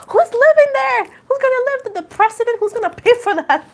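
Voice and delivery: high, loud squealing disbelief; mock outrage. Ranting to viewers about house prices, high loud outrage. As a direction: shriek it like prices are a joke.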